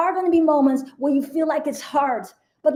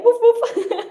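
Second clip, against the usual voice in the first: higher, giggly little woof woof; laughing mock woof; 10 semitones higher, 4 dB louder than usual. Bad wolf pulling you back, a laughing higher mock bark. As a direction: bark playfully through a laugh. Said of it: "This one saying".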